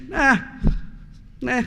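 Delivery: mocking, high brush-off; sarcastic imitation of a careless no; dismissive nah. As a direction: toss it away like the city shrugging off the schools. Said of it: "and we're saying".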